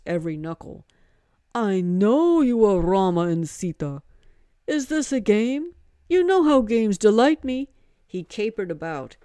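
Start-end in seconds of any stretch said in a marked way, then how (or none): 1.5-7.7 loud, higher, delighted Hanuman; a mischievous god who loves games; playful monkey god. Acted. Monkey god teasing Rama and Sita, loud and playful.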